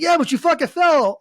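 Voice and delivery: imitated friend's high, insistent yell; loud, high shouted comeback; shouting down. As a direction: holler it down, insisting. Acted shouted exchange.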